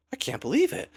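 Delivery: imitating her stunned awe; stunned disbelief. Rinsu stunned by Ku's speed.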